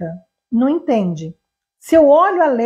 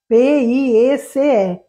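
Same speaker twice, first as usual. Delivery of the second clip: slow spelling; much slower than usual. Shown as a demonstration. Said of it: "eu já coloco a palavra lá".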